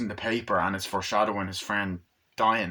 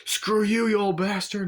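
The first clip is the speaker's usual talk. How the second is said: high, raised-voice jeer; rowdy taunting; 11 semitones higher, 5 dB louder than usual. Acted. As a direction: yell abuse from a speeding car. Gang smashing mailboxes, acted.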